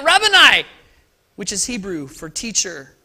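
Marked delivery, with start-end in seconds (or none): 0.0-0.7 cried out. Acted. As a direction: cry out in joy. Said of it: "cried out".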